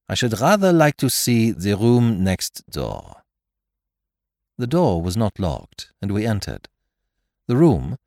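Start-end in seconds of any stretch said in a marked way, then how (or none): 0.1-3.2 put-on slow, deliberate French-accented English; Poirot's French accent. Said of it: "he remarked".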